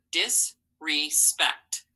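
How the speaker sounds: Disrespect drawn out slowly, syllable by syllable. Shown as a demonstration. Teacher models Disrespect slowly before the class writes it. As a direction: read Disrespect slowly so each part is heard. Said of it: "slow model reading".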